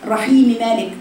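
usual speaking voice

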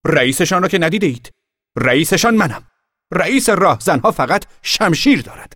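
put-on high, loud proud boy; boastful boy. A narrator's reading. Boy boasting to the girl that he leads bandits. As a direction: puff yourself up.

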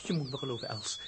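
soft, hushed plea; quiet pleading. Acted. Father pleads with Els softly that he is innocent. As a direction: beg her softly to believe you.

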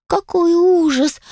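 high, slow squeak; squeaky fairy voice. Acted. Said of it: "пропищали в один голос феечки".

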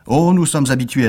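usual speaking voice

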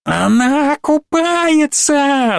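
very high, slow gleeful shriek; shrieking monster. Acted. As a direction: squeal it out like a delighted monster. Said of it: "заверещало чудовище на столе". (визг чудовища, очень высоко и протяжно)

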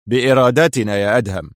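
a touch of gruff insistence. A narrator's reading. Jailer rebuking his defiant prisoner, mildly gruff. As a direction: say it with mild, curt harshness. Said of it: "قال موشي في غلظة".

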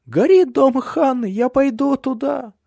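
very high, loud, slowing wail; little girl crying out, determined to go; distressed child. A narrator's reading. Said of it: "всхлипнула Суль". (высокий плачущий детский голос)

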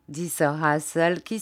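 plain reading voice

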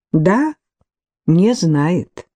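plain reading voice

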